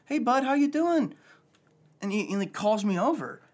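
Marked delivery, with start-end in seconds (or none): 0.1-1.1 high, friendly imitated greeting; imitating grandpa. Retelling meeting the grandpa, a high, friendly mimic. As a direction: throw it out cheerily like a kindly old man. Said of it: "looked at me as I'm sitting down and goes".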